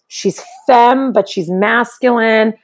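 ordinary talk

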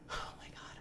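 hushed horrified whisper; whispered shock. Real, not acted. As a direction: breathe it out in disbelief. Gasps at the scene in horror.

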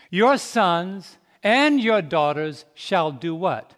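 usual speaking voice

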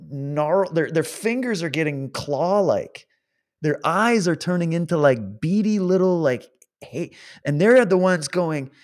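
ordinary talk

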